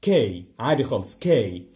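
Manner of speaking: plain hard k sound; نطق كي عادي. Shown as a demonstration. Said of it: "تنطقه كي".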